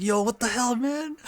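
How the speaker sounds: high-pitched mock indignation; retold outrage. Members protesting Kasuga's forgetting, mock outraged. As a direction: imitate affronted disbelief.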